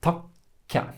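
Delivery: "To ka" with a silent wait before the k releases; held K. Shown as a demonstration. Teacher showing how to wait, break inside "To ka". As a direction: stop after "To", then release "ka". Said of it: "we wait a little bit".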